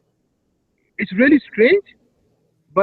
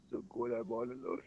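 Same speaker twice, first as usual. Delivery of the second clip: whispered; 11 semitones lower, 26 dB quieter, faster than usual. Acted. Lonely dramatic lament.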